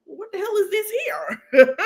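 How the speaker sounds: high, shrieking laugh of disbelief; shocked outburst. Retold or imitated. Retelling her reaction to the same dress, high shocked squeal. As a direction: squeal it out in mock horror. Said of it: "I say".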